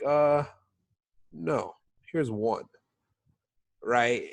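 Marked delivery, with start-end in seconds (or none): 0.0-2.6 reluctant. Retold or imitated. Retells puzzled customers grudgingly refusing.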